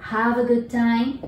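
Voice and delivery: slow, clear "Have a good time"; slow teacher's model; slow model phrase. Shown as a demonstration. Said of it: "全部have a good何々とつけてみましょう".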